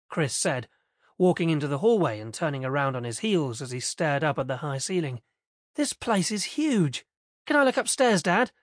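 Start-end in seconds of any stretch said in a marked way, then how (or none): 5.7-8.5 put-on excited kid. A narrator's reading. Boy amazed by huge new house.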